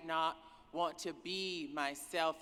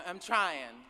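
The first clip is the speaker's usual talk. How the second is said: ordinary delivery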